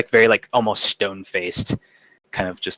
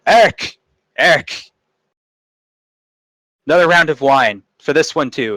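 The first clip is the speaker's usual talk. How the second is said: loud call across the tavern, much higher; 6 semitones higher, 6 dB louder than usual. Acted. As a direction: shout over the room for another round. Calling the bartender across tavern.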